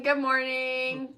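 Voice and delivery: ordinary delivery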